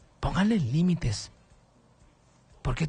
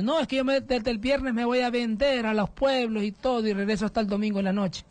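higher mock voice of the excusing mother; mother's excuse; 6 semitones higher than usual. Radio host mimicking the mother's excuse, higher voice. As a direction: play her lightly, pitched up.

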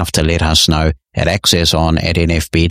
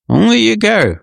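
amused exclamation; 13 semitones higher than usual. Real, not acted. Amused at listener's odd opinion.

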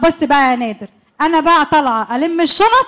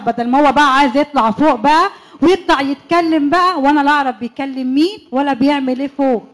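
ordinary delivery

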